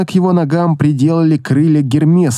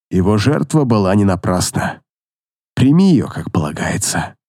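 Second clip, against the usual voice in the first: consoling; 6 semitones lower than usual. A narrator's reading. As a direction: comfort her gently. Consoling grieving girl.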